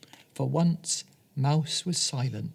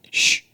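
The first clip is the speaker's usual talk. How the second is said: shushing; 5 dB louder than usual. Acted. Hushing the mouse.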